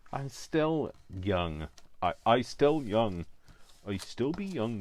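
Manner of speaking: put-on slow, drawn-out Somerset farmer accent. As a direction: stretch it out in a mock Somerset burr. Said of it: "suddenly got developed a Somerset accent".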